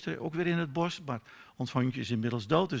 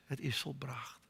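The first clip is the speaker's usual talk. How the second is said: hushed whisper of the last words; 12 dB quieter than usual. Real, not acted. Preacher praying over the cross, whispering the words. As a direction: breathe it out as a whisper.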